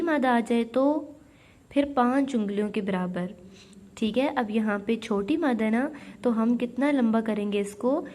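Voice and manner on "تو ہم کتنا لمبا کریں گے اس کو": ordinary talk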